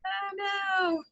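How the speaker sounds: high, soft, drawn-out little wail of dismay. Real, not acted. Teacher finds a mistake in the lesson, softly dismayed. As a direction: sing out a small high-pitched dismay.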